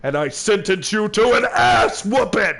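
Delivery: a mock judge screaming a guilty verdict; shouted verdict. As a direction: bellow the sentence.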